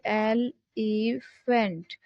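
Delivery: "एलिफेंट" slowly in three separate beats; syllable by syllable. Shown as a demonstration. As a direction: break "एलिफेंट" into three syllables, as if clapping each. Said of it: "साथ में clap कर सकते हैं". ("एलिफेंट" को तीन हिस्सों में धीरे-धीरे)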